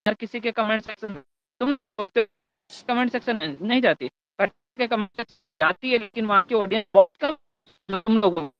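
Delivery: choppy, breaking-up call audio; voice cutting out. Real, not acted. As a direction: voice dropping out on a bad line. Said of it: "आपकी आवाज़ बहुत कट रही है". (कटती हुई आवाज़)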